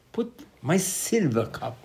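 SILVER CUP drawn out slowly; slow, weighty "silver cup"; stress on silver cup. Retold or imitated. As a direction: stretch out "silver cup" for emphasis. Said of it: "Torah emphasized silver cup".

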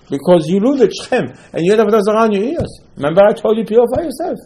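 high, emphatic scolding; accusing reproach. Acted. Yaakov answering the sons he suspects, accusing.

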